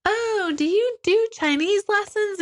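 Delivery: high, chirpy nosy-mom question; mock perfect mom. A nosy mom quizzing a tired mother, high and chirpy. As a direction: ask like a judgy supermom. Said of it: "Moms are like".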